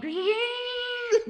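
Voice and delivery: high sustained mouth-made whirr; blender whir. Acted. Blender with his heart turned on, high whirring imitation. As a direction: hold a high motor whine. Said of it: "turns it on".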